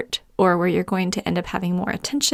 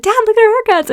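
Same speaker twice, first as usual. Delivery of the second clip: put-on a light high kid voice, much higher and louder; imitating excited kids; 14 semitones higher, 6 dB louder, faster than usual. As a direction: chirp it like proud little girls. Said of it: "'cause they said".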